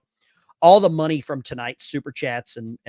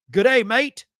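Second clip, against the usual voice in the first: higher-pitched, lightly attempted Australian accent; bad Australian accent; 8 semitones higher, 4 dB louder than usual. Host greeting British veteran Bill in the chat, bad Australian accent. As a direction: greet him in a rough mock Australian accent. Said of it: "probably a horrible Australian accent".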